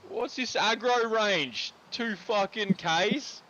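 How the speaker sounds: slow, high, outraged disbelief; exasperated. Real, not acted. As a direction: stretch out the outrage at the game. Shocked at game enemy range.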